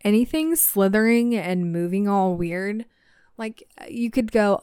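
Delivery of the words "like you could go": plain reading voice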